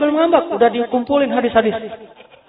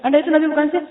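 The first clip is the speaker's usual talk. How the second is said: jealous challenge; 6 semitones higher, 5 dB louder, much faster than usual. Retold or imitated. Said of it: "Aisyah cemburu dan marah".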